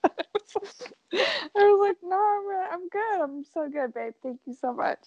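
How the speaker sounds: high-pitched, laughing through the quote; laughing retelling. Retold or imitated. Wife declining husband's shower hint, retold through high laughter.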